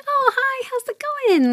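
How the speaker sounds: mock a breezy shallow greeting; fake chirpy greeting. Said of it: "I don't do a lot of, like".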